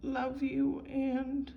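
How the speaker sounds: fighting back tears while she retells her parents' promise; voice breaking. Real, not acted. Recalling her adoptive parents' love, voice breaking with tears. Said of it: "I'm getting emotional".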